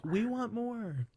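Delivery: high, drawn-out mock plea from fans; imitated fans. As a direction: mimic an audience asking, slowly and high. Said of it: "people are like".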